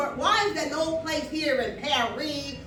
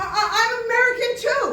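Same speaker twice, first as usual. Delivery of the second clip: high, loud, hurried declaration; blurted protest; 10 semitones higher, 9 dB louder, faster than usual. Retold or imitated. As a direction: burst out with it, stammering first. Blurting her protest to waiter.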